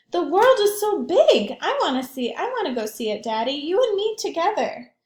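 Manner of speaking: put-on high, excited child; a small girl dreaming aloud to her daddy; little girl voice. Child Alma speaks to father.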